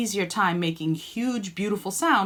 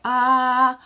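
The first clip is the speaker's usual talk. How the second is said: ordinary talk, nothing marked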